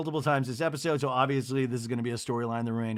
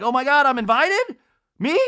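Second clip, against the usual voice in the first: high, loud burst of disbelief; mock astonishment; 11 semitones higher, 9 dB louder than usual. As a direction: act out shrieky, over-the-top surprise. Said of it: "And I'm like".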